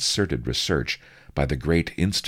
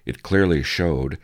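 ordinary delivery, nothing marked

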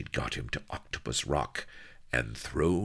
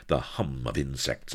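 plain reading voice

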